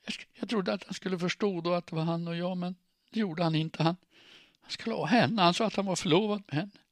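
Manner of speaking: high-pitched, loud, tearful outpouring; sobbing. A narrator's reading. Jilted woman explaining he was engaged, sobbing. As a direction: pour it out through sobs, voice pitched high. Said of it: "hon fortsatte snyfta".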